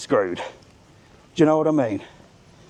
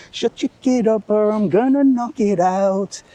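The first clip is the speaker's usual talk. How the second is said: mock threat; 8 semitones higher than usual. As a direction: lean in and threaten the mum. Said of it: "I just looked at her with, with evil eyes".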